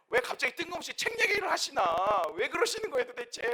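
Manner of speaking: high, loud protest; acted exasperation. Interviewee protesting pastor's untimely book offer, acted exasperation. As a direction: complain in disbelief, voice pitched right up.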